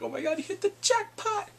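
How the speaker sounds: very high-pitched squeal; gushing friends. Retold or imitated. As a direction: imitate excited girlfriends gossiping. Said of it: "all her friends like".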